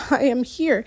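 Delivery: ordinary delivery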